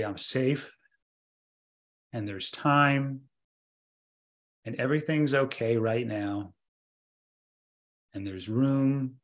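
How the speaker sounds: soft, slow calming voice with long pauses; gentle self-soothing practice voice; slow soothing demo. Shown as a demonstration. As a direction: say it slowly as if settling your own body. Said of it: "you notice how it was my voice and my tone and my breath".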